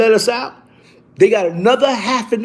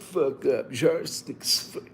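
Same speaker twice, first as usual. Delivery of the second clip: muttered aside; 6 semitones lower, 11 dB quieter than usual. Retold or imitated. Muttering dismissal to bragging cellmate.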